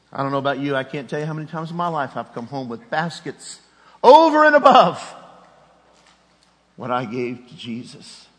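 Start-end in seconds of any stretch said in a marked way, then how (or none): 4.0-5.0 high, loud burst over and above; sudden shout. Acted. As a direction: throw the phrase out in triumph. Preacher's sudden exclamation.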